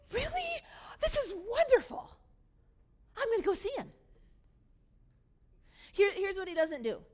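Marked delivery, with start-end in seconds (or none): 0.1-3.9 very high, gushing joy after a gasp; gasp, then squeal with hammed-up delight; over-the-top happy surprise; mock delight. Delighted reaction the brother doesn't have.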